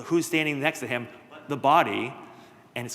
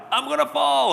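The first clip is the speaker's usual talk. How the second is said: much higher, louder ghostly wail; spooky ghost call; 13 semitones higher, 6 dB louder than usual. Acted. Ghost in the chimney calls for the third time, high eerie wail.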